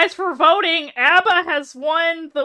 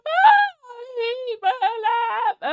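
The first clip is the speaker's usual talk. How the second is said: laugh-crying; 9 semitones higher, slower than usual. Real, not acted. Laughing at own embarrassing clip.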